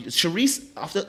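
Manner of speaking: ordinary delivery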